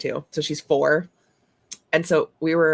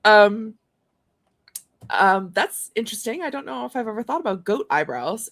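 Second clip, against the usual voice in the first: higher, louder puzzled oh okay; mock surprise; 5 semitones higher, 6 dB louder than usual. Aunt retelling her baffled reply to the niece. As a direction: act caught off guard.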